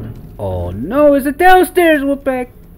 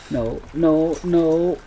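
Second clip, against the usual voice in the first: normal talking voice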